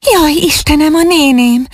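high-pitched cry of alarm; frightened exclamation. A narrator's reading. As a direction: gasp it out in rising dread, without shouting. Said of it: "kiáltott fel ijedten".

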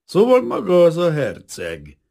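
high, loud, drawn-out hostile taunt; slowly pin the man down with loud contempt; hateful contempt. A narrator's reading. Officer confronts the prince, full of hatred. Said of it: "Gyűlölet volt az arcán".

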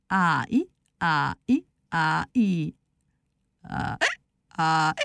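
measured haw-hee syllables with pauses; backwards braying. A narrator's reading. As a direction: chant the bray in reverse. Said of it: "Na most visszafelé". (visszafelé mondott, szaggatott szamárhang)

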